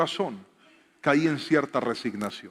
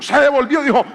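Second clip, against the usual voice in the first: louder, higher, building preacher's cry; preaching at full force; 10 semitones higher, 9 dB louder than usual. Acted. Pastor tells church of daily family prayer, fervent preaching. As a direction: rouse the congregation with rising fervor.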